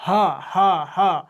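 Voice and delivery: ह repeated as a lone sound pushed from the throat; throat sound demo. Shown as a demonstration. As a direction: separate each ह, pressing on the throat. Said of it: "साफा कंठ पर जोर पड़ रहा है".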